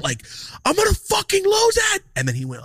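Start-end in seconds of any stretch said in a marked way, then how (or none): 0.6-2.0 high, loud reenacted blow-up; furious retold shout. Storyteller reenacting the moment he lost it, shouting. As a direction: shout it as if snapping, pitch way up. Retold losing his temper.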